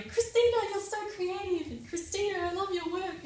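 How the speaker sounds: high, bright gushing praise; gushing Americans. Retold or imitated. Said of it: "gushing at me".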